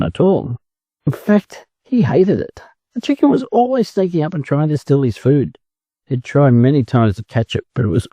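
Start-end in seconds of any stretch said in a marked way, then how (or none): none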